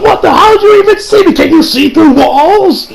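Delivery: high, loud stunned questions; mock disbelief. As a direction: sound like a gamer who just got spotted. Stealth gamer getting caught.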